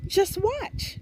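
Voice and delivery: very high teasing "just watch"; secretive fireflies, sing-song; high firefly voices. A narrator's reading. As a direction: tease it up high like little fireflies. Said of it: "they replied".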